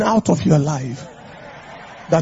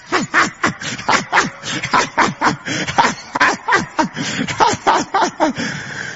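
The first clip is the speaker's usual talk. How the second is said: big booming preacher laugh; 10 semitones higher than usual. Real, not acted. Preacher laughing at his own joke, booming laugh.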